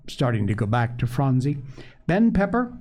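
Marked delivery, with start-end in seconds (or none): 2.1-2.8 high, emphatic promise that settles at the end; rally a downhearted brother; determined cheer. A narrator's reading. Sister promising sick Ben some chickens, determined cheer. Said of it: "she talked faster than ever".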